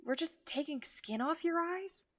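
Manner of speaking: horrified disbelief; alarmed. Acted. Mel startled at the eye skin, loud and high.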